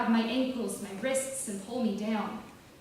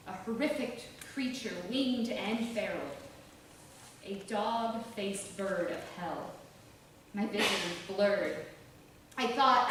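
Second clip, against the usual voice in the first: plain reading voice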